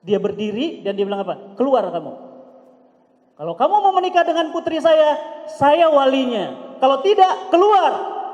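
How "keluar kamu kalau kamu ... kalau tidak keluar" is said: loud furious order, slowing on saya walinya; angry shout. Retold or imitated. Angry father throwing the suitor out, furious shout. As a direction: bellow like an enraged father.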